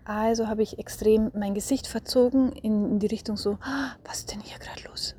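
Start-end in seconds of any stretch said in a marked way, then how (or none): 3.6-5.2 gasp, then hushed amazed whispering. Retold or imitated. Mother startling her raging child out of a tantrum, hushed. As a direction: make your voice tiny, soft and astonished. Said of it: "habe die Stimme auch ganz leise weggemacht".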